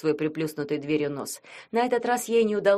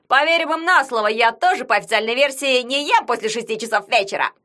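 sports commentator; 6 semitones higher, 6 dB louder than usual. Acted. Yagun commenting the match.

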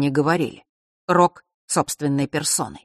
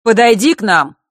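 loud, high summons; raised, commanding call; 6 semitones higher, 9 dB louder than usual. A narrator's reading. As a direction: order her over from across the room. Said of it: "вдруг окликнул Нортон-старший".

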